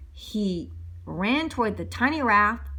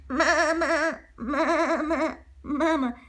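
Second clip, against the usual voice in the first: crying donkeys; 5 semitones higher, much slower than usual. Acted.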